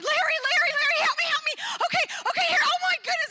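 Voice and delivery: high, loud, urgent cries for help; frantic calling. Retold or imitated. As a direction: call your husband in a panic, fish on the line. Calling husband, huge fish hooked.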